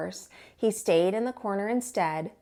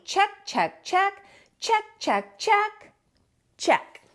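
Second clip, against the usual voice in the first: high chanted checks; cheery checklist; 10 semitones higher, slower than usual. A narrator's reading. Moose ticking off his Christmas list, high and cheery. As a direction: tick off each item brightly.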